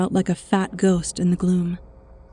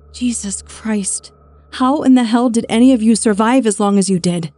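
exasperated outburst; 3 semitones higher, 6 dB louder than usual. A narrator's reading. Woman berating revolutionaries for their stupidity, exasperated. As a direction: explode in exasperation.

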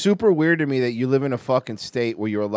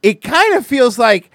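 sarcastic; 8 semitones higher, 6 dB louder than usual. Real, not acted. Mocking the news line.

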